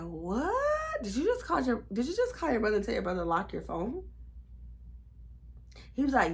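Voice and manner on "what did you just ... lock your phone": high shocked gasp then incredulous question; can't believe what she just heard; shocked disbelief. Retold or imitated. To her boyfriend on the phone lock, shocked disbelief.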